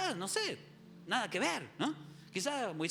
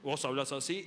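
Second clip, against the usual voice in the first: lower, ordinary deep speaking voice; thick male voice; 5 semitones lower than usual. Shown as a demonstration. Man talking normally, deep thick voice. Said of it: "Los hombres que tienen voz gruesa".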